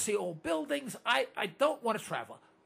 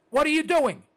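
louder, higher holler at the listeners; 4 semitones higher, 6 dB louder than usual. Real, not acted. Host hollering at his radio listeners. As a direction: yell it at them to wake them up. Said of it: "I'm hollering at you".

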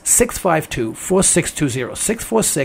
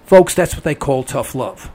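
normal talking voice

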